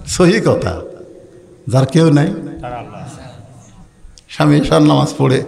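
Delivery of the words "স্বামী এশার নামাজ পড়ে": normal talking voice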